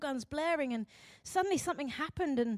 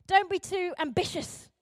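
higher-pitched mock warning; admonishing; 5 semitones higher, 3 dB louder than usual. Society warning the driven, raised and chiding. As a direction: chide them with raised pitch.

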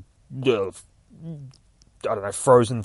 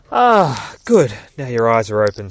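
Poison Ivy; 10 dB louder than usual. A narrator's reading. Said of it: "says the, um, Poison Ivy voice".

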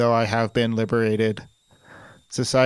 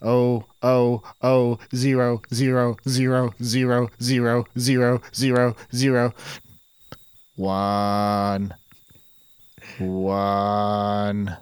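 robot glitching into binary; slower than usual. Acted. Robot malfunction spouting binary.

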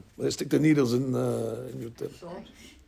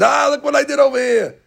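higher, smug gloating; a hint of mocking Titus gloating; brazen boast; 8 semitones higher than usual. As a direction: swagger out bragging. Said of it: "he walks out, brazen".

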